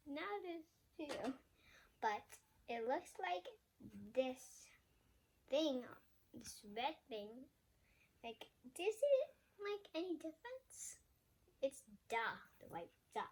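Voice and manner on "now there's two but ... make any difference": plain reading voice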